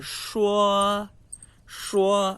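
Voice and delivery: shuo com a língua dobrada para trás; retroflex shuo. Shown as a demonstration. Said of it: "sons retroflexos que a língua dobra pra trás". (shuo retroflexo)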